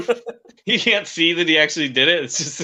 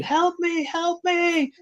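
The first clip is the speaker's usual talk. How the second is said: shrill-pitched pretend cry for help; imitated screaming; 4 dB quieter, much slower than usual. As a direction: imitate a screaming woman in high falsetto. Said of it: "we heard a woman screaming".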